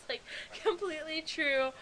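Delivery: giggling through the words; amused, laughing "completely true"; laughing agreement. Real, not acted.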